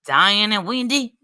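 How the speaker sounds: hammed-up New York accent, punched opening. Streamer reading out a rival's post, mock New York accent. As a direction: put on a rough attempt at New York. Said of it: "this New York accent".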